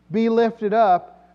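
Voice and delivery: slow, loud, high declamation; psalm recitation. Acted. Preacher reciting the psalm, slow loud proclamation. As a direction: draw it out as a ringing command.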